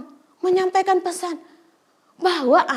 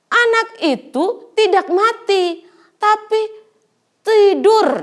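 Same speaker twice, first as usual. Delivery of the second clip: firm declaration; 7 semitones higher, 6 dB louder, slower than usual. Acted. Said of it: "Kata Tuhan Yesus".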